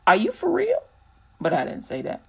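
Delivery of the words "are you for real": high, disbelieving street comeback; mock street incredulity, pitched way up. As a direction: drag out "really" in pure disbelief. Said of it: "I was about to go".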